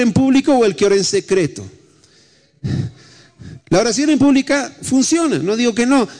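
high, driving sermon voice; fervent preaching. Real, not acted. Pastor urging the congregation, fervent high preaching. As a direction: preach it out with rising fire.

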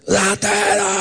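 high, drawn-out call held back in volume; mock Tarzan yell. Replaying his cry to scare the thief, mock yell. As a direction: imitate a long alarm cry without full force. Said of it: "como Tarzán".

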